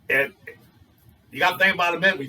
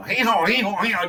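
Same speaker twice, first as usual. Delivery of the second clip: higher-pitched mock hee-haw; laughing donkey bray; 6 semitones higher than usual. Comedian mimics the donkey laughing at the whispered secret, braying hee-haw. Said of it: "just laughing his head off".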